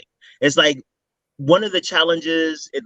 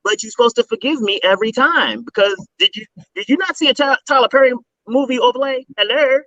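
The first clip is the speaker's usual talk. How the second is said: mock indignant; 8 semitones higher than usual. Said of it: "It's like".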